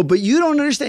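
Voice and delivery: retold pleading objection; whiny protest. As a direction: Rick protesting to his sponsor. Protesting to his sponsor.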